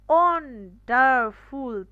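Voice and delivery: Wonderful loud and slow as ON, DAR, FUL; split into three syllables. Shown as a demonstration. Teacher showing three syllables, loud and slowly separated. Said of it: "syllable দেখতে পাচ্ছি".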